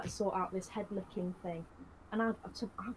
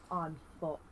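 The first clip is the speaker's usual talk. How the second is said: ordinary delivery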